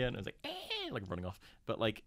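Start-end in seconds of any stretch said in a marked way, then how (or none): 0.4-0.9 mock creature screech. Imitating creature scurrying off.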